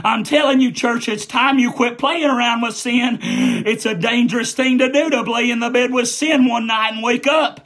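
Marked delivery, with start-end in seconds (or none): none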